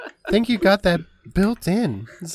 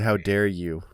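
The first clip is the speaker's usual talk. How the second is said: mock outrage; 8 semitones lower than usual.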